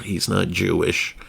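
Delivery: normal talking voice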